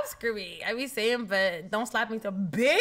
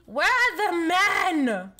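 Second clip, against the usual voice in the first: calling out; 4 semitones higher, 4 dB louder, much slower than usual. Acted. Calling out again in game.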